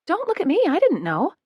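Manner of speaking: denying any part in it; insisting. A narrator's reading. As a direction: hands up, insist it wasn't you. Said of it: "Abby insists".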